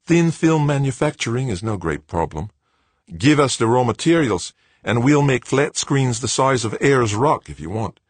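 put-on high, light young man's voice; young Australian man. A narrator's reading. Director's voice, higher.

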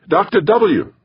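high-pitched eager call. A narrator's reading. Young digger hailing his boss to the grave, calling out. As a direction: call him over in a high voice. Kid calling over suit radio.

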